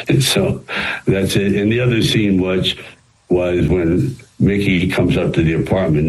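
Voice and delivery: usual speaking voice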